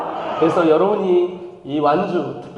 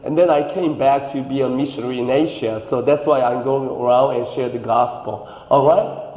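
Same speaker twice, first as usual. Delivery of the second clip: put-on slow, lower English with an American accent; 5 semitones lower, much slower than usual. Pastor posing as an adopted American missionary in slow, lower American English. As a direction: drawl it like a Korean-American missionary. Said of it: "미국에서 왔습니다".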